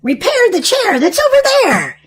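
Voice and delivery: high spell chant; witch casting a spell. Acted. Witch casts another spell.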